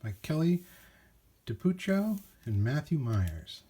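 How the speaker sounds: reading a picture book aloud; story narration. A narrator's reading. Narrator opening a picture book, storytelling voice. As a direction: read it warmly to children. Reads title and authors.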